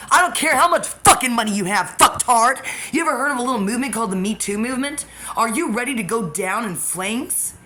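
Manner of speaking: loud, high, furious yelling; furious shout. Real, not acted. Ranting at the man stalking her, furious shouting. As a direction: scream the threat at him.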